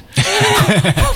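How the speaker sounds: high-pitched giggle; high laugh. Real, not acted. Laughing about John vanishing.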